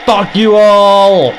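slow, loud, drawn-out yell; furious roar. Real, not acted. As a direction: roar it at the horde. Enemies gathered, raging.

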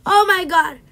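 high, loud frightened shriek; scared scream. Real, not acted. Another jump scare.

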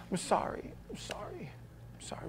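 soft whispered apology; whispered sincere sorry. Retold or imitated. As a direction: breathe it out, truly sorry. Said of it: "And it was sincere".

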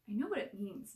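usual speaking voice

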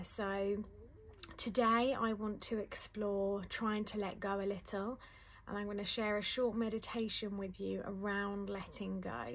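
ordinary talk

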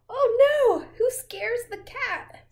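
high loud alarmed cry; excited. Pretend. Reading the chaos to the child, high and loud. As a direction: shout it like disaster is coming.

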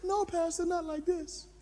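high, soft correction; trainer's voice. Acted. Trainer correcting the eager pastor, high and soft. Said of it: "He said".

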